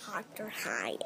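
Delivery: breathy unvoiced whisper. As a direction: whisper the name secretly. Child whispers the name.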